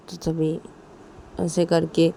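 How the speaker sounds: ordinary delivery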